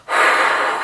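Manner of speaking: exaggerated heavy breath; heavy angry breathing. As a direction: imitate a friend huffing heavily. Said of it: "heavy breathing".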